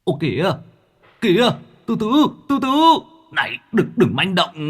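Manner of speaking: high, panicky ghost voice; a startled ghost yelping for mercy. A narrator's reading. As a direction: ghost yelling at Mạc to hold off. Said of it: "Tiếng la oai oái vang lên".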